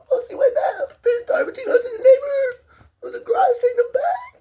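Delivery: high, loud tearful voice; crying. Real, not acted. Remembering his childhood tricycle, crying hard. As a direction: sob the memories out. Crying while describing toy.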